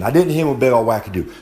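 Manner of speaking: normal talking voice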